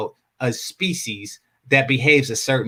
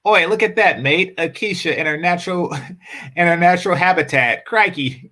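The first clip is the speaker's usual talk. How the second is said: mock Australian; 4 semitones higher than usual. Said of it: "he says".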